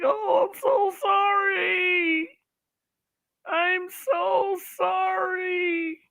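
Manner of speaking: very high, drawn-out fake wailing; hammed-up sobbing plea, stretching every vowel in mock despair; mock waterworks.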